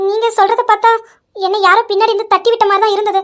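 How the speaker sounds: ordinary delivery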